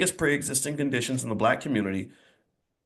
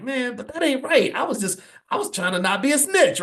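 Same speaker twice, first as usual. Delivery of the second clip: put-on higher voice of a nine-year-old whining it's unfair; protesting boy; 10 semitones higher than usual. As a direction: plead like a wronged kid. Boy protests his mother's verdict.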